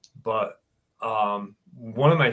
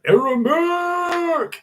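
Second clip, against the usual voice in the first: long, very high, slow drawn-out call; 4 dB louder, much slower than usual. Real, not acted. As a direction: stretch the name out like a hail across a field. Calling out a chat name.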